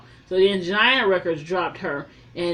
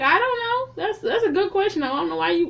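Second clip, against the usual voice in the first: baffled squeak; 10 semitones higher than usual. Retold or imitated. Mock baffled reply.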